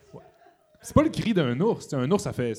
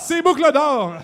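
excited; 11 semitones higher, 6 dB louder, slower than usual. Real, not acted. Excited realisation, Goldilocks.

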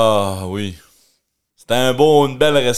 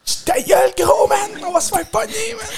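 much higher shouted hush; shouted shush. Acted. Kid shouting shush at a sibling.

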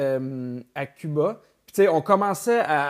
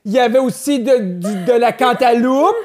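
put-on loud, high, slow old lady voice; imitating a grandmother; 6 semitones higher, 8 dB louder, slower than usual. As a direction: speak up like to a deaf granny. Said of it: "On dirait que je parle à ma grand-mère".

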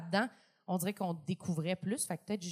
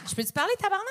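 protestation; 11 semitones higher, 5 dB louder, much faster than usual. Real, not acted. Veut interrompre les autres juges.

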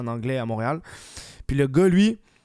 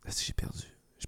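whispering; 14 dB quieter than usual. Real, not acted. Loses train of thought.

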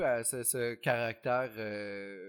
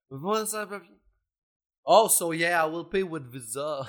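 higher, louder put-on English accent; mock English accent; 4 semitones higher, 8 dB louder, slower than usual. Acting out a grocery store chat, light English accent. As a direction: play a cocky stranger at the grocery store. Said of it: "il fait comme".

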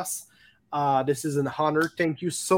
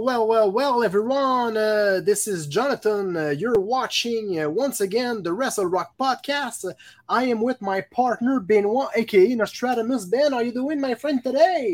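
upbeat, higher-pitched host intro; podcast show opening; 5 semitones higher, 3 dB louder than usual. Real, not acted. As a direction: open the show with a big rising welcome.

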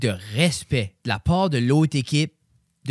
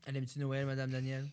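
doux; 14 dB quieter than usual. Real, not acted. Voix basse à l'enfant.

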